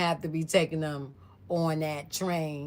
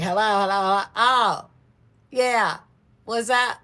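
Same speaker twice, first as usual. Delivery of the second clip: loud, raised-pitch hellos; mock calling out; 5 semitones higher, 8 dB louder than usual. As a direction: call into a dead phone line, hammed up. Mimics caller left talking to nobody.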